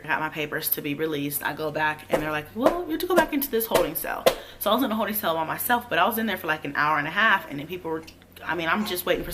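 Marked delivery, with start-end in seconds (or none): 2.5-4.2 high, lilting official voice; mimicked jail staff. Jail staff sending her back to the cell, mimicked. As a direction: mimic an official in a raised pitch.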